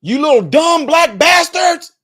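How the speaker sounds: high, loud slow hateful yell; hateful shouted slur. Retold or imitated. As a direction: sneer it out like a street bigot. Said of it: "say that".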